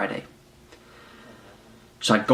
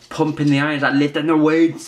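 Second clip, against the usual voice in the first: put-on higher, louder Austrian bodybuilder accent; Arnold Schwarzenegger accent; 6 semitones higher, 4 dB louder than usual. Telling viewers about lifting weights in a mock Austrian accent. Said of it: "That's my Arnold Schwarzenegger accent".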